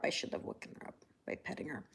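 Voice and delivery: fast whisper so the cat stays asleep; hushed guilty whisper beside the cat; whispered aside. Real, not acted. Aside about waking sleeping cat.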